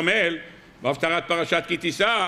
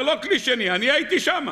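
higher, emphatic retold insistence; soldier insisting; 6 semitones higher than usual. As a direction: swear you were there yourself. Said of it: "הוא אומר".

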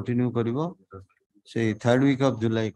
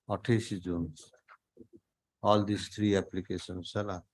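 normal talking voice

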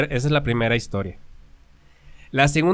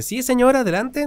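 put-on lightly higher, eager young man offering; polite boy's voice; 10 semitones higher than usual. Muchacho invita al vagabundo a comer, voz más aguda y amable. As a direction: sound like a kind boy inviting him in.

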